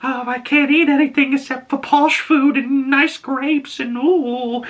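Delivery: very high, whiny mock voice of a pampered kid; mocking spoiled boy. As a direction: mimic a posh brat to tease him. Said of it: "teasing each other".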